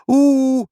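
loud, very high drawn-out hoot; warning "ooh". A narrator's reading. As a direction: hoot a shrill alarm. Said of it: "riep Ibbeltje". (luid, hoog waarschuwend oe-geroep)

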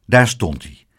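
ordinary delivery, nothing marked

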